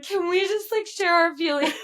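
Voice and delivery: high, sing-song, laughing plea; playful high-pitched girly plea; high gushing voice. Retold or imitated. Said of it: "we're just like".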